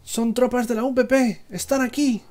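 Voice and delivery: high panicked shout over the radio; pilot shouting. Acted. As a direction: yell it, under fire. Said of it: "que grita".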